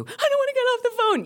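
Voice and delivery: very high pleading mock voice; panicked caller. Caller in bathtub to radio host, high panicked plea. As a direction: squeal it like a clinging caller.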